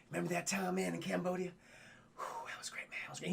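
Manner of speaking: soft, fading to a breath; wistful reminiscing. Retold or imitated. Mac talking to the moon about his dead friend, wistful. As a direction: murmur it wistfully into the night.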